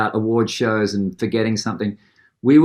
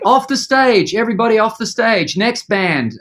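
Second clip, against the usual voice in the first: bossy crew clearing stage; 9 semitones higher than usual. Retold or imitated. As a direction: order everyone off the stage. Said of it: "and they're like".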